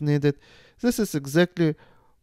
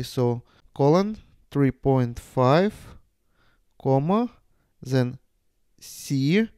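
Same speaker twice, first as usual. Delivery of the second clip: dictating. Shown as a demonstration. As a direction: read out symbols one at a time. Dictating notation with branch lengths.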